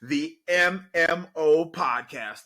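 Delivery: high, loud podcast opener; big energetic welcome for listeners; hype intro. Real, not acted. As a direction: greet the crowd like a hype host.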